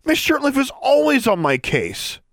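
high, heated protest; rising anger. A narrator's reading. Girl denying she is the teacher's favourite, angry protest. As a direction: protest indignantly, pitch right up. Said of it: "Her voice rose angrily".

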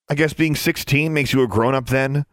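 girl mocking an older cadet, sarcastic. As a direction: needle him with sarcasm. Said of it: "Alexandra asked sarcastically".

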